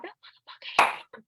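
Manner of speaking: whispered cussing, imitating mom; retold cursing. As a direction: curse under your breath, as if mid-prayer. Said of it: "she was cussed like".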